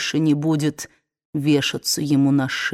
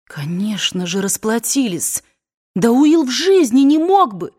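higher, louder, indignant protest; protesting young woman; 6 semitones higher, 5 dB louder than usual. Acted. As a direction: protest hotly. Scarlett protesting.

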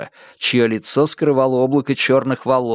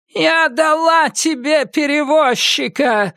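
deafening scream. Acted. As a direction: scream it up to the sky. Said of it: "снова крикнула она".

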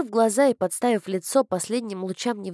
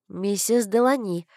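ordinary delivery

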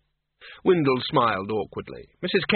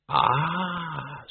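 long, higher, dawning realisation; bright; 4 semitones higher than usual. A narrator's reading. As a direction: stretch it out brightly as if catching on. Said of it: "said Reg brightly".

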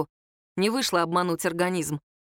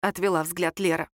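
ordinary delivery

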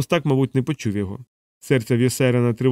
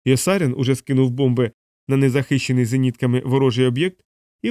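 usual speaking voice

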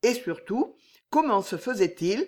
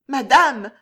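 higher, louder, quavering call; trembling voice; 6 semitones higher, 9 dB louder than usual. A narrator's reading. Maid Juliette calling Jeanne, voice trembling. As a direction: falter as you call her mistress. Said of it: "d'une voix tremblante".

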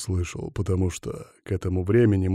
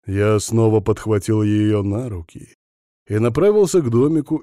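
plain reading voice

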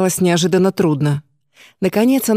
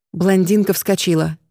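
ordinary delivery, nothing marked

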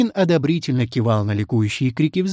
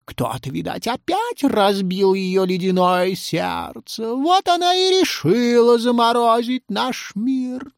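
drawn-out, high voice of a grave poet; melancholy storyteller character; 10 semitones higher than usual. Acted. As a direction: voice a mournful, theatrical sage. Pushkin speaks in story.

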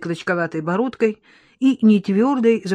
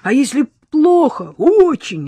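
high, louder pleading objection; acted patient's insistent appeal; timid protest attempt; 7 semitones higher, 6 dB louder, slower than usual. A narrator's reading. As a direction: venture a protest, pitched up. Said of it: "заикнулся больной".

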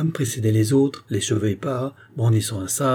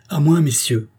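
raised, higher rallying cry; cry for help; 5 semitones higher, 4 dB louder than usual. A narrator's reading. King summoning his grenadiers, crying out. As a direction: call guards to your side. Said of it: "ne peut s'empêcher de crier".

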